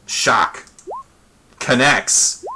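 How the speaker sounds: ordinary talk, nothing marked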